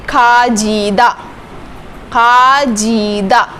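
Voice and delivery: खाजीदा drawn out slowly, j sound clear; slow pronunciation demo. Shown as a demonstration. Teacher drilling the j sound, slow. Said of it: "इसकी sound आएगी ज की".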